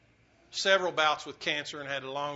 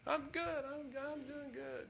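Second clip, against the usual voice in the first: high, much softer, slow put-on weak voice; frail dying man; 11 semitones higher, 9 dB quieter, slower than usual. Pastor voicing Clyde in his hospital bed, high and weak. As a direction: echo a frightened sick man brushing it off.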